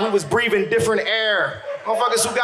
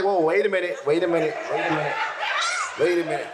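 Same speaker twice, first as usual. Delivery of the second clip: cop calming her; 4 semitones lower, 4 dB quieter, faster than usual. Retold or imitated. Cop calming the shouting girlfriend.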